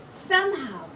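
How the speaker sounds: usual speaking voice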